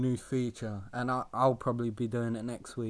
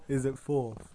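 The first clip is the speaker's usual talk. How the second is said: ordinary talk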